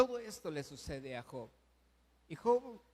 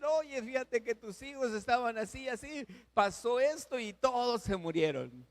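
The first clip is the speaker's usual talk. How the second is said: higher, urgent bearer of bad news; excited messenger; 7 semitones higher, 4 dB louder than usual. Messengers bring the terrible news.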